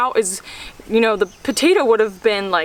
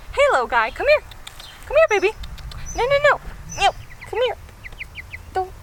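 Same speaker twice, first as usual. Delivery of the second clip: cooing to a pet; 11 semitones higher, faster than usual. Real, not acted. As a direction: coax the little animal to come. Calling pet over.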